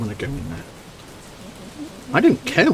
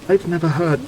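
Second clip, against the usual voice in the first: usual speaking voice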